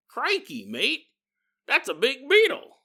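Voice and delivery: put-on a jokey Australian bushman accent marvelling at the beetle. Imagining an Australian finding the bug, in a jokey Australian accent.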